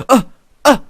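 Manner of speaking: short high jumpy grunts; little startled uhs, spaced apart; imitated nervous yelps. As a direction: mimic a nervous spectator. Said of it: "like, nervous".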